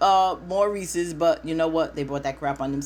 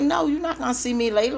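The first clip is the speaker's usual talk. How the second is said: indignant retort; 9 semitones higher, faster than usual. Retold or imitated. Woman snaps back at him.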